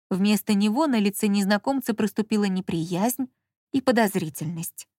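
plain reading voice

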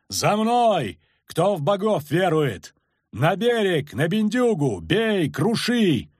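high, drawn-out battle cry; yelling. A narrator's reading. Kagyr leads soldiers to battle, yelling. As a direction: whip the men into a charge. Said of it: "орал Кагыр".

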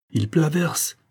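high-pitched protest of dismay; dismayed cry. A narrator's reading. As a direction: exclaim high, in consternation. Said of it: "s'écria Céline consternée".

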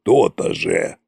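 pleased grumble. A narrator's reading. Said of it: "довольно проворчал Кощей".

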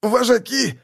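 high, strangled croak; choked wheeze. A narrator's reading. Propagandist held by the throat, choked high wheeze. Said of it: "прохрипел пропагандист".

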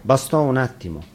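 ordinary delivery

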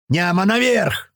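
slow, high call downward; drawn-out high shout; yelling. A narrator's reading. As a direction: yell down with stretched words. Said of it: "орёт ему вниз Туман".

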